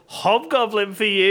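mock grand sales pitch; imitated pushy barman. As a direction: proclaim it like a pushy bartender. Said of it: "and he was like".

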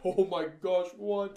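loud, high retold astonishment; blown away. Viewer reacting to sudden credits, blown away. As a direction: exclaim it in stunned disbelief. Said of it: "I was blown away".

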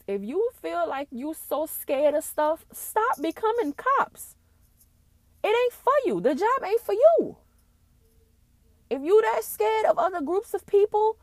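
high, loud, drawn-out angry shout; real outrage. Real, not acted. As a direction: shout it at them, pitch way up. Outraged rebuke of scared police.

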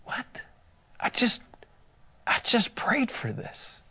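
breathless hushed disbelief; soft stunned inner voice; stunned whisper. Retold or imitated. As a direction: whisper it, amazed. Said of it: "I remember thinking".

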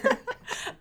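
unvoiced giggle held back; stifled giggle. Real, not acted. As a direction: suppress a giggle under breath. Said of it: "which is why I'm trying not to laugh".

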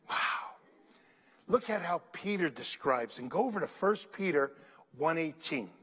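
normal talking voice

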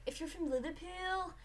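imitated Scouse; Liverpool accent. Shown as a demonstration. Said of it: "you sort of sound like this".

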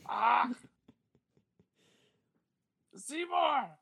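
very high, raspy Marge-style calls; Marge Simpson impression. Showing off his Marge voice to a friend. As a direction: call out Seymour like Marge. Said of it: "I can do a pretty good Marge".

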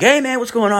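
high, bright phone hello; old buddy calls up, upbeat and high; cheery greeting. Retold or imitated. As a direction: greet him like an old friend.

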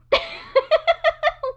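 high, loud giggle; high burst of laughter. Real, not acted. Laughs at reaction.

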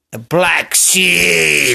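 slow dramatic drawl; drawn out. Acted. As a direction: linger over each word. Repeated phrase for effect.

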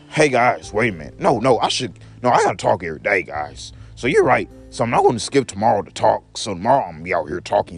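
normal talking voice

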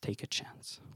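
breathless whisper, fading out; whispered echo. Acted. Echoing refrain, fading to whisper.

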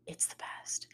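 breathy whispered aside, as if sharing a secret. Acted. Whispering to listeners that fall is the best. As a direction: lean in and whisper it.

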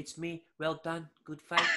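a friend mimics Trump's phone call, hammed up high and loud; Trump impression. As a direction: ham up the president congratulating a fighter on the phone. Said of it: "That's pretty much how it went".

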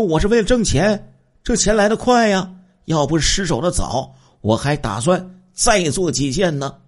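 loud, high-pitched mock boasting; retold brazen bragging. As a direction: crow it like an unrepentant crook, pitched well up. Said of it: "杨东艳落网之后还叫嚣呢".

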